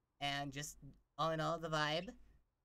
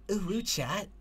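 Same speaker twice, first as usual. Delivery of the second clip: higher, cutesy character voice; twinkling voice; 4 semitones higher, 8 dB louder than usual. Shown as a demonstration. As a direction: greet chat in a high twinkling voice. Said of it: "That, I got it right".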